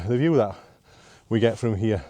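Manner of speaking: ordinary talk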